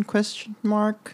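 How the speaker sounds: high drawn-out question mark; rising question. Shown as a demonstration. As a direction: read the question mark aloud, rising slowly. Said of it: "says the punctuation aloud".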